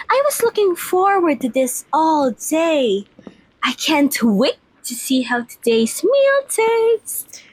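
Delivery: ordinary talk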